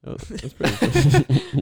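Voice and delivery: breaking into laughter; laughing. Real, not acted. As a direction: laugh through the words. Laughing at bus trip.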